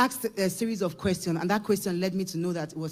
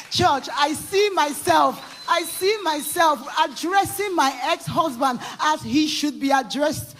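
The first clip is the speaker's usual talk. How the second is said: loud, high, slow preaching; full-force testimony preaching; 7 semitones higher, 6 dB louder, slower than usual. Real, not acted. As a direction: proclaim it to the church.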